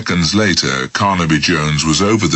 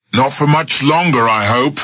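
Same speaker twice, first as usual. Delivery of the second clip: serious; 9 semitones higher than usual. Acted. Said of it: "Smith said seriously".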